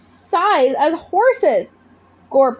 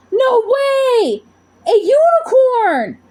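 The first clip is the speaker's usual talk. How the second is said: amazed; 12 semitones higher, 3 dB louder, slower than usual. Acted. Girl sees a giant unicorn.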